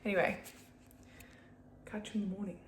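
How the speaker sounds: normal talking voice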